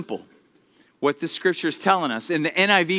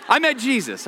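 excited; 5 semitones higher than usual. Real, not acted. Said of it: "It was so fun".

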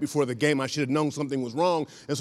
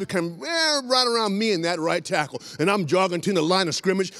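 usual speaking voice

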